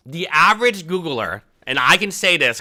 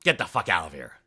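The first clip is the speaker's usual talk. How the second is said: low dismissive; 9 semitones lower, 6 dB quieter than usual. Retold or imitated. Vendor dismissing him.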